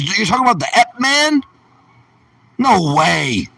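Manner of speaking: high, loud incredulous outrage; mock disbelief. Challenging viewers over a forbidden name, in mock disbelief. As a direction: act scandalised, pitched right up.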